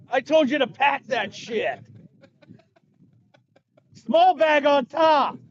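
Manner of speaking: high, slow mock yelling at a bellboy; mock screaming boss. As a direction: pretend to scream orders, drawn out. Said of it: "He's probably screaming at his bellboy".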